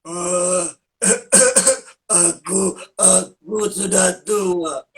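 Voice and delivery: lightly voiced old man, slow with a cough; a shaky grandpa clearing his throat, slowly; slow coughing old man puppet. Shown as a demonstration. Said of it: "kalau misalnya dia mau jadi kakek-kakek".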